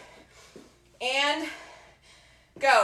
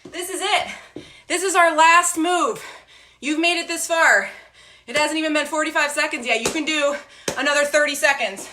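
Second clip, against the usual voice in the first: loud, high, pumped-up workout cues; barking gym coach; 5 semitones higher, 4 dB louder than usual. Acted. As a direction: drive the class through the last seconds. Coach pushing final set.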